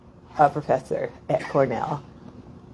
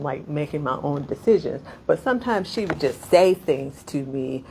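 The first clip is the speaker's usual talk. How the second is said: normal talking voice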